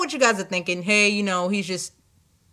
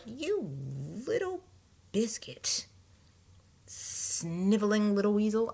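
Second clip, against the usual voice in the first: muttered insult; 9 dB quieter, slower than usual. Retold or imitated. Annoyed insult said under her breath.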